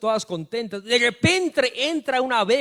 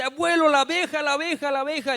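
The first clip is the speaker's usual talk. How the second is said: put-on high panicked girl's cry, fading; desperate child; 9 semitones higher, 4 dB louder than usual. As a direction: beg grandpa to save her. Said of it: "Y la niña volvió a desesperarse". (niña desesperada, aguda, que se apaga)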